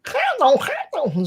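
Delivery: high-pitched Scouse "calm down"; mock Scouse. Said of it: "Scouse occasionally is a difficult one".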